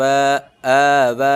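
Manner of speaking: اے و with each vowel stretched long and evenly; drawn-out recitation of اے و; both vowels drawn out. Shown as a demonstration. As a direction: hold both vowels in اے و for equal length. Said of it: "دونوں کو برابر کھینچنا ہے".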